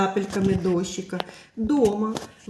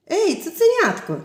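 high, louder playful call; teasing cloud calling; 8 semitones higher, 4 dB louder than usual. Acted. Cloud teasing the puppy below, playful call. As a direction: tease the puppy from the sky.

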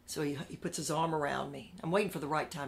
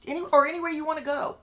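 mock eager offer; 10 semitones higher, 7 dB louder, faster than usual. Bosses' mock generous offer.

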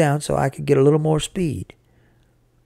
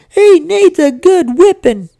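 very high, loud, slow wail; bawling little sister; 10 dB louder than usual. A narrator's reading. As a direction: bawl it out like an indignant child. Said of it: "puckered up and started bawling".

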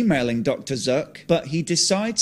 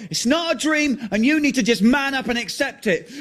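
furious screaming quarrel; 12 semitones higher than usual. Acted. John and Martin quarrelling on camera, screaming fury. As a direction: hurl every word back at him.

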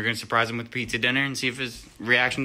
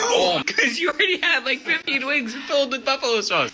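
high-pitched laughing tease; laughing teasing; 12 semitones higher than usual. Real, not acted. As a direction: tease him while cracking up. Teasing about the wings.

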